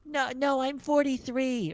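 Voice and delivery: put-on very high, squeaky little girl voice. A grown woman caller on the phone, very high and squeaky. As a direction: pitch right up like an eight-year-old girl. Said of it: "It's even higher pitched than that".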